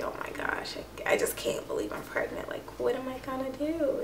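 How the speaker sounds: retold shock under the breath; hushed disbelief. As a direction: whisper it as if still taking it in. Retells stunned private disbelief.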